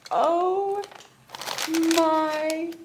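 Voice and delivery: high, breathless oh my; squealing with joy at a gift; overwhelmed gasp. Real, not acted. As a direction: gasp it out in real delight.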